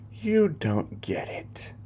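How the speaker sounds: high, soft and slow villain; villainous defiance. Acted. New spawn defies his master.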